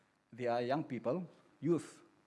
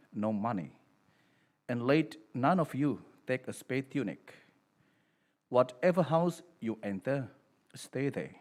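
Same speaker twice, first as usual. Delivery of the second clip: solemn, louder priestly reading; Gospel proclamation; 5 dB louder than usual. Acted. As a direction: proclaim it to the whole congregation.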